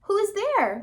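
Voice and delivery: high call through the door; a little pig's high call. Acted. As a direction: call out to the visitor. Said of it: "called the second little pig".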